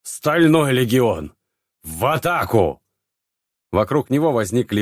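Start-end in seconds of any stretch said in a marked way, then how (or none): 0.0-2.8 slow, rasping war cry; rally the knights with a rasp; hoarse battle cry. A narrator's reading. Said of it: "прохрипел он".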